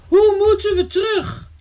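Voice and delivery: high-pitched wail of alarm; frightened cry. A narrator's reading. Elsje asks the boy how to get back, frightened cry. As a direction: call out, scared of being stranded. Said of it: "riep Elsje verschrikt".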